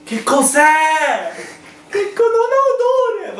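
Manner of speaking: surprised. Real, not acted. Blindfolded, smelling mystery food.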